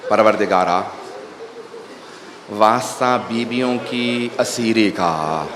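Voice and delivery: very low, soft murmured dua; hushed, solemn pleading to God; supplication prayer. Acted. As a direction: lead the prayer quietly, pitch dropped right down. Leads closing supplication.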